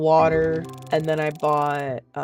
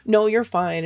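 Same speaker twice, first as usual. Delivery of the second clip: brighter quote; 6 semitones higher, 6 dB louder than usual. Retold or imitated. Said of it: "And she goes".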